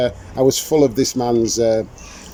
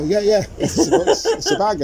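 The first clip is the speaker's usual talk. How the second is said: much higher, laughing through the words; 10 semitones higher, slower than usual. Retold or imitated. Artist laughing while insisting to a customer that it's a bag. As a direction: giggle at your own absurd pitch. Retelling selling bag portrait.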